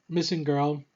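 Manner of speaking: usual speaking voice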